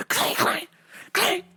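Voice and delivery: high, ringing vocal clangs; sharp high bursts, like smashing metal; clanging metal imitation. Storyteller imitating the pump being smashed on the street, high clangs.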